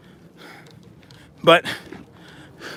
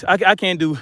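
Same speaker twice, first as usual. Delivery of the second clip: usual speaking voice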